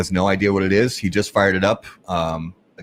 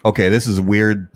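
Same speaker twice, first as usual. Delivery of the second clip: usual speaking voice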